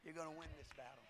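soft fast murmured promise; quiet repeat. Shown as a demonstration. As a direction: drop it to a near hush. Same promise dropped to quiet.

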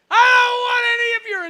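put-on furious yell slamming the door on a kid; cranky neighbour roaring at a kid selling donuts at the door, shouting; angry shout. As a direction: bellow it high and loud like an enraged homeowner. Angry man refusing donut seller.

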